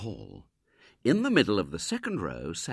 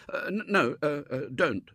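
high, stammering plea; desperate boy; 9 semitones higher, 4 dB louder than usual. A narrator's reading. Boy begging a girl not to cry, desperate stammer. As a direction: stumble and falter, begging her to stop. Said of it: "said William desperately".

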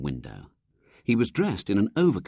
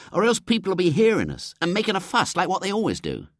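higher, cautious schoolboy voice; Ginger; 12 semitones higher, 3 dB louder, faster than usual. Acted. Ginger cautioning William, high boyish voice. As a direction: warn the gang to keep it down.

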